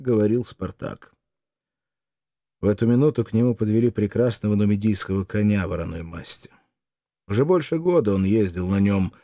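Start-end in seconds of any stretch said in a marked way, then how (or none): none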